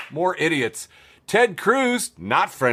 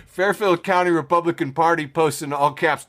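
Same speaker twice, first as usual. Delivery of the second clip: usual speaking voice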